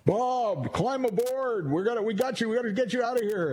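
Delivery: high, monotone megaphone call. Acted. Helicopter guy hailing Bob on the roof, mock megaphone voice. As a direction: imitate a rescuer hailing through a megaphone. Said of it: "the megaphone".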